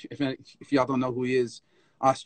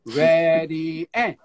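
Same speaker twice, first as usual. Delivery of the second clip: long drawn-out, raised "ready, and"; louder, sustained dance teacher's cue; teacher's count-in; 3 semitones higher, 6 dB louder, much slower than usual. Retold or imitated. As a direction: stretch out the count-in to set the tempo. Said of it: "She'd say".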